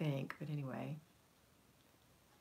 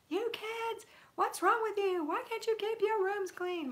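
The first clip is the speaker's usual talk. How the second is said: shrill, much higher, louder screech of a fed-up mom; mock yelling at kids; 4 dB louder, slower than usual. Mother yelling at her kids about messy rooms, hammed-up shriek. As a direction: shriek it like a screaming banshee mother. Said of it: "I used to yell at my kids".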